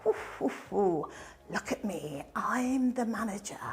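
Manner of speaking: soft, breathy self-satisfied cooing; mock smug preening. New manager alone in the office, mock preening whisper. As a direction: quietly gloat over the new title. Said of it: "and I'm sort of".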